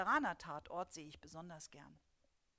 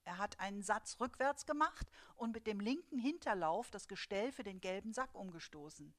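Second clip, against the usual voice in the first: ordinary delivery, nothing marked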